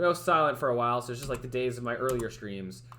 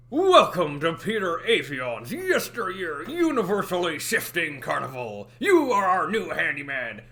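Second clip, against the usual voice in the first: slow, loud gruff carnival host; Mister Krabs voice; 3 semitones higher, 5 dB louder, slower than usual. Shown as a demonstration. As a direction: greet the new hire like Mister Krabs. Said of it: "kinda turned into Mister Krabs".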